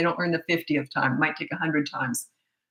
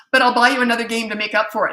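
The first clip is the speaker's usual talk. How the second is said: hurried appeasing; 4 semitones higher, 6 dB louder, faster than usual. Retold or imitated. Hurried promise over son's crying.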